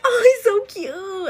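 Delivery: gushing. Retold or imitated. Husband melts at the puppy.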